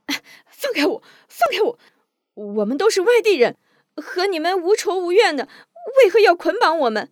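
high-pitched, frantic protest; struggling captive. A narrator's reading. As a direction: plead and struggle against the ropes. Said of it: "蓝三妹也拧着身子挣扎着". (挣扎着尖声抗议)

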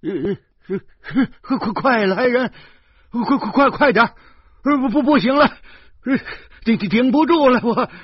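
high, loud, stammering cry for help; desperate shaky yell while holding a child over a cliff; trembling panicked yell. A narrator's reading. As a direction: shout for help, straining and terrified. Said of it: "我颤巍巍地叫着说".

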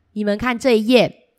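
plain reading voice

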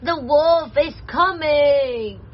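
long, wailing piggy warning; slow frightened pig wail; squeaking pig. Acted. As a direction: draw it out as a scared pig. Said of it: "Squeaked the pig".

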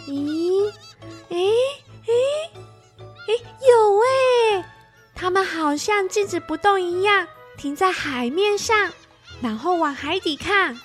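put-on high, bright girl's voice rising with excitement; excited little girl. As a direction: gasp it out in wonder as a child. Child voice, excited discovery.

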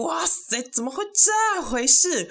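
drawn-out, high-pitched disbelief; shocked exclamation. Retold or imitated. Guest sees the groom enter, stunned high exclamation. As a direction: let the shock soar up high and stretch it out.

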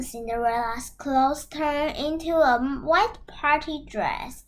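ordinary delivery, nothing marked

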